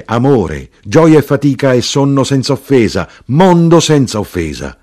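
high, loud, chanting exclamations; exultant tavern proclamation; shouted litany of toasts. A narrator's reading. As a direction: cry out each item like a toast. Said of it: "l'arrotino gridò".

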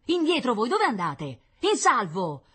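loud yells to get back; rough shouted orders. A narrator's reading. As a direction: shout like firemen driving children away. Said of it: "li ricacciano a urlacci". (urlacci per ricacciare indietro)

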